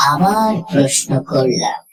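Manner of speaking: usual speaking voice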